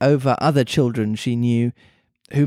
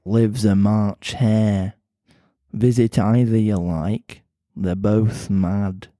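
slow, lower Mancunian cat voice; Mancunian accent; 4 semitones lower, slower than usual. Acted. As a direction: drawl it slowly in a Manchester accent. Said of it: "pretty Mancunian sounding accent".

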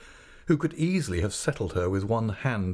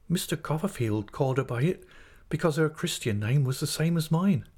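higher-pitched, apologetic explanation; the mother's voice, pitched up; meek mother figure; 7 semitones higher than usual. Acted.

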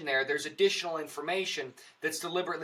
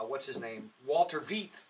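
ordinary talk, nothing marked

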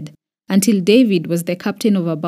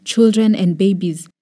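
ordinary delivery

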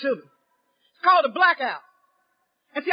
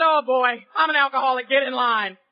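sassy retort; 4 semitones lower than usual. Retold or imitated. Retorts to the country man.

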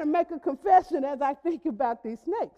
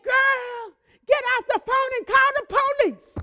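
higher, louder big-sister voice; sister's advice; 7 semitones higher, 4 dB louder than usual. Retold or imitated. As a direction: boss your little sister around, loud and high. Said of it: "She said".

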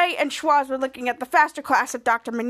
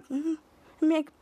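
plain reading voice

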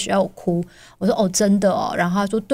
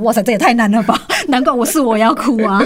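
louder, amused astonishment; mock shock; 3 semitones higher, 5 dB louder than usual. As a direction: exclaim in mock dismay. Mother exclaims about hard test. (夸张惊叹)

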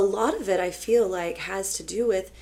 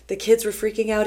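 usual speaking voice

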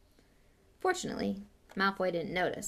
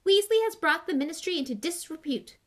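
higher, louder outraged quote; indignant; 9 semitones higher, 6 dB louder than usual. A narrator's reading. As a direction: declare it with loud indignation. Quoted outraged statement in the clipping.

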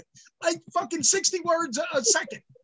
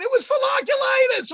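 much higher, shouted retelling; retold scream; 11 semitones higher than usual. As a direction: yell it down the phone. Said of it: "I screamed in the phone".

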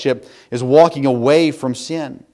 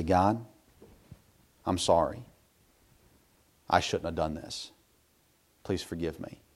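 low, hushed confession; subdued plea for forgiveness; contrite prayer; 7 semitones lower, 10 dB quieter than usual. Retold or imitated. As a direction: confess humbly, soft and low. Acted prayer of confession to God.

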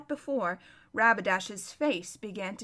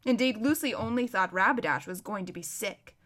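ordinary delivery, nothing marked

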